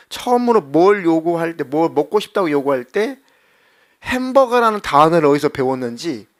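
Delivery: ordinary delivery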